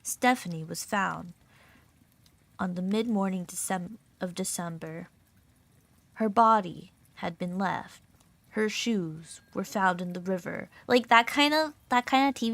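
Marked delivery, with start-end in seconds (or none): 0.0-10.7 low, grave documentary voice with pauses; measured, ominous low narration; true-crime TV narrator. Retold or imitated. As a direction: imitate a murder mystery show narrator. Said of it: "where the guy's like".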